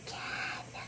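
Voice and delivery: faint whispered can; barely audible whisper. Acted. Brother taunting sister in the faintest whisper. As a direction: breathe it almost silently. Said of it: "he whispered so low that I could hardly hear him".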